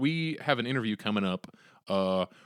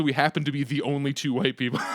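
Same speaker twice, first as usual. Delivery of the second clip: ordinary talk, nothing marked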